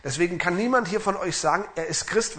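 ordinary talk, nothing marked

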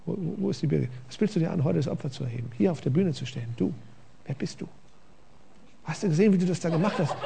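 soft sneering accuser, half-laughing at the end; quiet devil voice. Retold or imitated. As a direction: murmur doubts like the tempter. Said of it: "der kommt leise her".

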